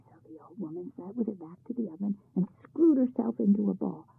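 plain reading voice

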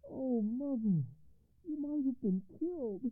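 high, emotional cry; commander crying out. A narrator's reading. Commander to his mother who survived, high emotional cry. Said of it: "cried the commander".

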